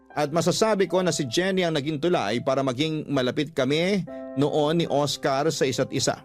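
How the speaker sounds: ordinary talk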